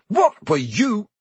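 high, drawn-out demand; shocked. A narrator's reading. Percy demands an explanation from Ron, shocked.

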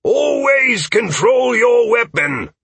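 slow, high, loud reprimand; drawn-out shout across a training field; shouted command. Acted. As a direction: bellow it like a drill instructor. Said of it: "Aldwin shouted".

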